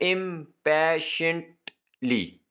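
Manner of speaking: "इम्पैशेंटली" slowly in four separate beats; syllable by syllable. Shown as a demonstration. Teacher dividing words for exercise, "इम्पैशेंटली" slowly in four parts. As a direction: pause between इम, पै, shunt, ली in "इम्पैशेंटली". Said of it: "syllable को divide भी करना है".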